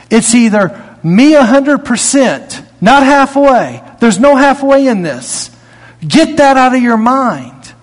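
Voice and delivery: high, pressing sermon voice, driving each point home; rising pulpit fervor; fired-up preaching. Acted. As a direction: preach it out to the congregation. Preaching at full force.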